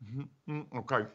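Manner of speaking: soft, low, hushed coaxing; mother quietly calming a daughter with Skittles; soothing parent. Pretend. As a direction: coo to a fussy child. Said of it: "It's like".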